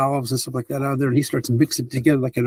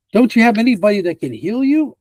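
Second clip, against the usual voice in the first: concerned question; 8 semitones higher, 3 dB louder than usual. Acted. NPC asks, concerned.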